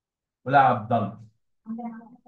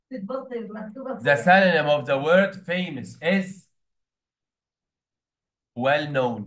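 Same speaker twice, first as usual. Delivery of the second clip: slow dictation; much slower than usual. Acted. Dictating to class.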